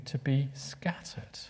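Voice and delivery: hushed, slow whisper; a solemn hushed whisper. Acted. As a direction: breathe it out slowly. Sermon drops to solemn point.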